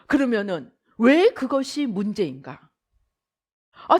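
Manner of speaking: ordinary delivery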